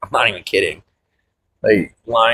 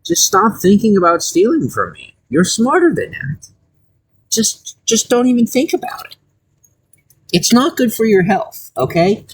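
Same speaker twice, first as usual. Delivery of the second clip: mock sweet warning; 9 semitones higher than usual. Warning an NPC off stealing.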